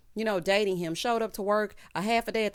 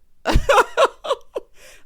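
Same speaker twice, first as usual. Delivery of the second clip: loud, high-pitched cackle; uncontrolled high laughter; big laugh; 14 dB louder than usual. Real, not acted. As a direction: crack up loudly, voice way up. Laughs at her own recap.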